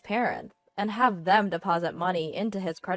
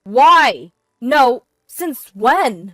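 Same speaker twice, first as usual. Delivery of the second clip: loud, high, halting shock; caught-out husband reeling; confused stammer; 5 semitones higher, 14 dB louder, much slower than usual. A narrator's reading. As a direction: blurt it out, rattled and slow. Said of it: "he stammered, his voice shaking with confusion".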